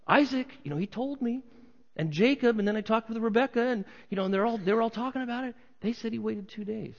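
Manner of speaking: high, chattery rumor-spreader; insistent gossip. Acted. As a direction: reel off your sources like an eager gossip. Acts out gossip naming sources.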